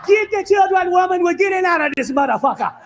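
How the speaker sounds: a mock-proud slave yelling at his wife to grab the kids; hammed-up defiant shout; indignant shout.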